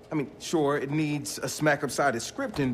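ordinary delivery

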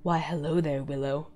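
low, soft creepy greeting; hushed, low villain greeting. Acted. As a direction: purr it quietly like a lurking creep. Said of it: "in a creepy, non-stalkerish way".

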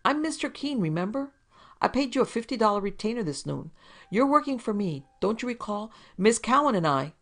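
high, coaxing wheedle; wheedling. Acted. Keene wheedling the detective into remembering him. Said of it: "He changed his manner to a wheedle".

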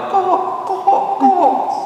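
high, squawking crow caw, three times; raven caw. Retold or imitated. Preacher imitating ravens cawing at the congregation's window. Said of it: "to go outside your window and go".

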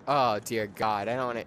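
normal talking voice

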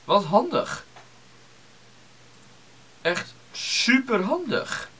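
high, slow, dripping sarcasm; sarcastic. Acted. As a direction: fake delight, drawn out. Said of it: "Dat was sarcasme".

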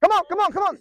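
high, loud repeated call; urging shout. Real, not acted. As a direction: push the player on with a yell. Coach urging player on.

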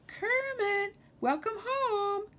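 high, drawn-out singsong greeting; musical calling voice. A narrator's reading. Lulu welcomes Kermit home, musical high call. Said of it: "a musical voice called out".

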